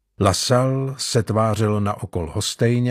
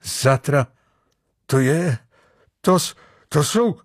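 halting, startled exclamation with rising pitch; stunned stammering; 4 semitones higher than usual. A narrator's reading. Sailor discovering the hidden men, stunned stammering. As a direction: stammer in dawning shock, broken by pauses. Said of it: "vyjeveně se rozhlížel".

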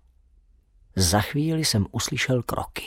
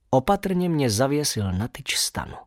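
usual speaking voice

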